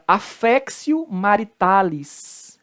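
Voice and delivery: slow affectio, "aféksio"; Latin T as C. Shown as a demonstration. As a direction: slowly model affectio with an S sound. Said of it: "o T com som de C".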